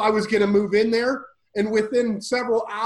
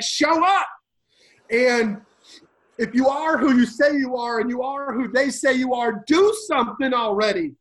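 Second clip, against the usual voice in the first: raised, much higher, pleading call to heaven; retold scream to God; 5 semitones higher than usual. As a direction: cry out to God, demanding a sign. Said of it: "I screamed out to God".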